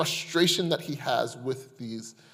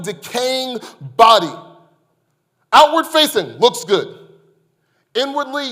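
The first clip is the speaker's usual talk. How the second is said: much higher and louder, building; rising sermon climax; 8 semitones higher, 11 dB louder than usual. Acted. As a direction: swell toward the climax.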